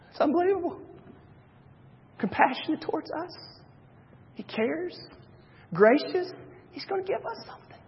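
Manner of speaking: high, soft, amazed whisper of wonder; Moses amazed. Retold or imitated. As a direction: marvel breathlessly as Moses hears God's name. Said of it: "You can only imagine that Moses is going".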